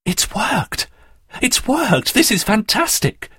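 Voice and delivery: hushed high gasp building to excitement; amazed delight. Acted. Daphne watches the android come to life, thrilled. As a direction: marvel breathlessly, pitch leaping up.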